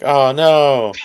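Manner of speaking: high, loud mock "oh no"; hammed-up dismay; imitated dismay. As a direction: cry it out like you're locked out. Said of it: "He's like".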